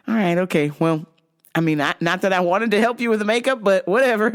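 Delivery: ordinary talk, nothing marked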